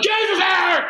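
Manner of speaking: high yelled protest; exasperated shout. Retold or imitated. Protesting as Eric keeps hitting, high shout. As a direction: yell at your friend to stop.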